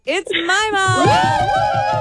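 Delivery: stretched-out high shout, like a ring announcer; hyped announcement. Pretend. Co-host announcing the contestant's nickname with a long, high shout. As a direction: belt out the name slowly, at the top of your lungs. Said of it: "Love your passion there".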